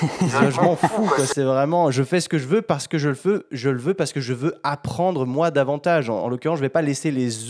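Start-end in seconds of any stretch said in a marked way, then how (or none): 0.0-1.2 slow drawn-out shrug of a line; lazy, dismissive drawl; carefree don't care. Retold or imitated. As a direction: stretch it out like you couldn't care less. Voicing a carefree don't-care attitude.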